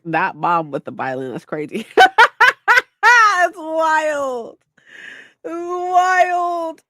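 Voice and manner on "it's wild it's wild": high, wailing laughter into words; laugh-cry. Real, not acted. Streamer laughing-crying at the wild story beat. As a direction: laugh until it nearly becomes a cry.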